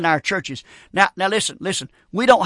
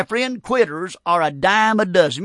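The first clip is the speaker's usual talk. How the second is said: ordinary talk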